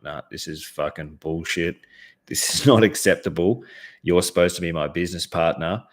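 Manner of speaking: usual speaking voice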